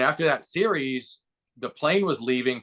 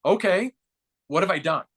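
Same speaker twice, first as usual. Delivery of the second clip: put-on kid-like excitement; 6 semitones higher than usual. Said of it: "like a little kid in fantasy camp".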